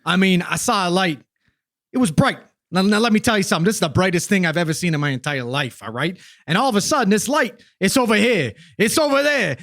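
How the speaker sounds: put-on worked-up Jersey witness raving about a light, Jersey accent; hammy New Jersey accent, getting excited. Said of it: "representing the wonderful diversity of New Jersey accents".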